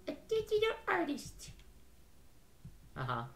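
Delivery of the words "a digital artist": very high squeaky put-on voice; high-pitched voice. Game character naming the girl's talent, high squeak.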